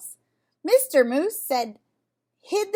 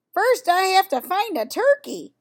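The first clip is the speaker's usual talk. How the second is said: higher, louder bellow in reply; bellowed back; 7 semitones higher, 6 dB louder than usual. A narrator's reading. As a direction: shout it back up to the sheep. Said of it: "Mister Moose bellowed back".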